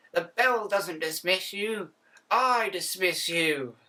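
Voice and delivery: put-on stern old teacher, slowing on the last line; old school English teacher dismissing the class. Shown as a demonstration. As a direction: scold the class like a strict schoolteacher. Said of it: "gonna do a better English, uh, English teacher voice".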